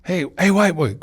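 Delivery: lightly voiced neighbour calling over; hinted cellmate calling out; inmate calling out. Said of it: "this guy constantly is calling over to me".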